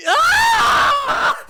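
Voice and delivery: loud, high piercing scream. Acted. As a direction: shriek in sheer terror. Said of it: "Another scream filled the room".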